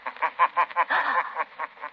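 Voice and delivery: high, distant, ghostly laugh; eerie mocking laugh. The invisible Shadow laughing to unsettle the old woman. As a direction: laugh like an unseen presence.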